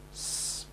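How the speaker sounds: "s" hissed alone before the vowel; isolated S. Shown as a demonstration. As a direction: give a long clean "s" on its own. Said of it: "For instance".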